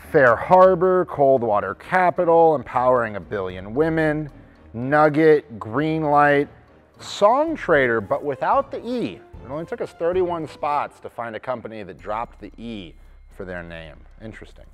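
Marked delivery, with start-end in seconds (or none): 0.1-9.2 projected roll-call of names; list announcer. Real, not acted. Host reading out a list of companies, announcing. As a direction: call out each company name with weight.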